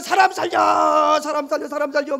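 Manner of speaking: loud, high panicked cries; shouting for help. Acted. Passengers in the storm shout for help. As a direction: yell to be saved.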